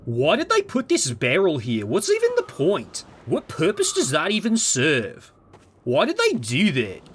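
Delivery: put-on whine, swooping up mid-phrase; hammed-up clueless video essayist; mock baffled YouTuber. Mocking critics over a barrel, swooping baffled whine. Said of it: "when I talk like Half-Life two voice".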